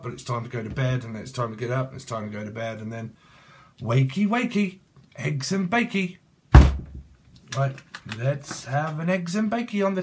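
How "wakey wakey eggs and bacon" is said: sing-song, higher wake-up call; wakey wakey. Pretend. Tarot reader rousing viewers, sing-song and higher. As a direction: chirp it like waking the kids.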